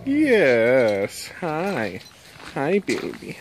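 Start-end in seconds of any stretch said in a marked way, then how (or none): none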